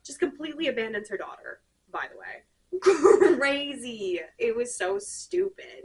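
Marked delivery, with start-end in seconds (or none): 2.7-5.8 loud laugh then amused scorn; laughing disbelief. Real, not acted. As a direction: laugh at how dumb it is. Laughing at the plot.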